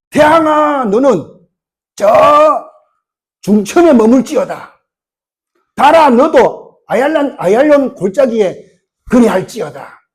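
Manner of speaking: loud, high proclamation, slowed on the moon line; Joshua's command. Acted. Joshua commanding the sun and moon, in a loud proclaiming voice. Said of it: "그러니까 여호수아가".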